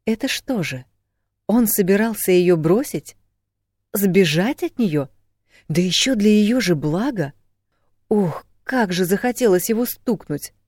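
high, incredulous outraged questions; heated indignation. A narrator's reading. Woman learning he meant to leave her, fuming. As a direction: fume with rising outrage.

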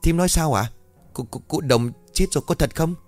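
woman stammering at news of a killing; stammering shock. Acted. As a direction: stumble over the words, scared. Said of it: "miệng ấp úng".

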